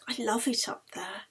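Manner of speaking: soft, wistful whispered protest; reluctant whisper. Acted. Anna whispers reluctantly as she leaves the top deck. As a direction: breathe it with regret. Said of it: "Anna obeyed reluctantly".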